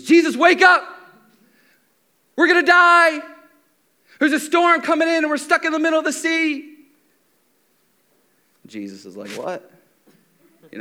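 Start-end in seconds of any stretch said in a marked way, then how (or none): 0.0-6.8 high, loud cry to wake someone; acted panicked yell. Disciple yelling to sleeping Jesus in a storm, panicked. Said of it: "One of them yells out to Jesus". 9.3-9.7 soft, groggy mock waking; just woken up. Jesus roused by shouting disciples, groggily puzzled. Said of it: "you wake up".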